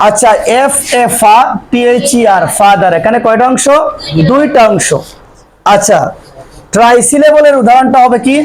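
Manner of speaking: ordinary talk, nothing marked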